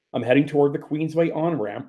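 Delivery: ordinary delivery, nothing marked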